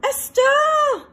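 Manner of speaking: high, loud delighted shout; excited cry. A narrator's reading. Rocket spotting a light, high excited shout. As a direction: cry out at the sight. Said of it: "she cried".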